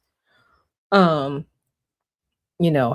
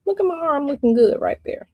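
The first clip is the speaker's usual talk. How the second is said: ordinary talk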